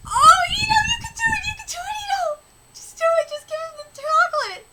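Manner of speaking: very high squealed encouragement; cheering on. Real, not acted. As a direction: squeal urgently at the character. Cheering on a story character.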